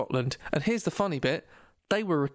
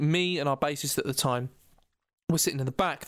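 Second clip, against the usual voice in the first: ordinary delivery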